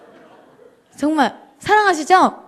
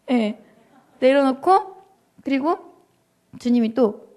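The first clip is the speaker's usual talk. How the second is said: ordinary talk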